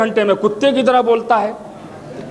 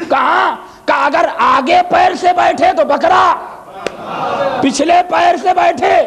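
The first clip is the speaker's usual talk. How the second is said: preaching at full force; 8 semitones higher than usual. Acted. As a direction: ring out each answer to the crowd. Ali's answer preached loud.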